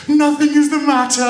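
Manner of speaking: very high, loud wail; crying children. A narrator's reading. Children hiding the truth from mother, wailing. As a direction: bawl the denial at top pitch. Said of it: "and cried all the more".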